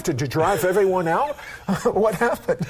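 loud, amused questioning with real laughter; laughing question. Real, not acted. Host teasing pastor about his congregation, laughing. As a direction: ask through a laugh.

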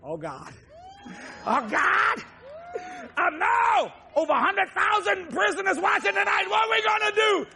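devil's hammed-up loud wail; panicking devil. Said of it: "the devil going".

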